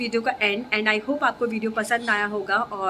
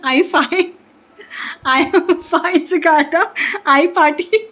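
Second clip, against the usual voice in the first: coaching a little one; 6 semitones higher, 8 dB louder, slower than usual. Real, not acted. Reminding a little one of the taught phrase, high and loud.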